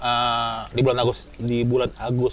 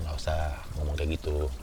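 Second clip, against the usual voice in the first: lower, quiet warning from a friend; a hushed, serious caution; pelan menasihati; 9 semitones lower, 7 dB quieter than usual. Retold or imitated. Friend warns in quoted speech. (menasihati dengan suara rendah dan pelan)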